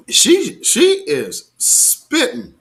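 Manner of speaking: high excited praise, slowed down; hyped praise. Real, not acted. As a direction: drag it out in delight. Praising the speaker.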